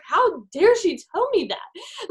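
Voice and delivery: slow, high hammed-up outrage; mock indignation. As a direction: act out being offended, stretched out. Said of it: "defensive at first".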